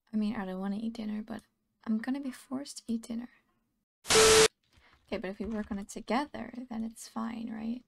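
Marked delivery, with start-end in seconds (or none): 4.1-5.2 loud. Real, not acted. Sudden loud exclamation.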